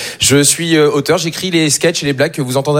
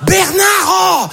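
put-on high shout across the square after her child; mother shouting after her runaway four-year-old; 13 semitones higher, much slower than usual. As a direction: yell the child's name, pitched up. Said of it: "et qui est là genre".

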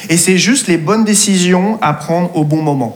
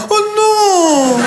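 high squealing «Oh non»; dismayed cry; 12 semitones higher than usual. Retold or imitated. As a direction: shriek it in dismay. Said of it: "elle a fait un".

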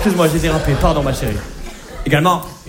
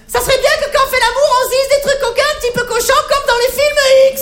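very high put-on girly voice; mock girlfriend. Girlfriend in bed asks for naughty talk, high girly voice. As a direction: play the coy girlfriend asking for dirty talk. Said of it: "elle me dit".

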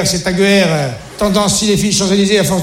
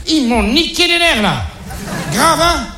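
high-pitched worked-up complaint; acted exasperation; 5 semitones higher than usual. Father fed up with his two teenagers, acted exasperation. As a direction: play the dad at the end of his rope. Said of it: "Je suis vénère, hein?".

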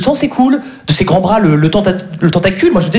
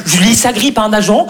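ordinary talk

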